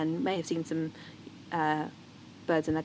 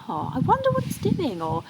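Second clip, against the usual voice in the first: higher, gentle wondering voice; curious wondering; 13 semitones higher, 3 dB louder than usual. Shown as a demonstration. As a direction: invite the child to wonder with you. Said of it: "ask a question about".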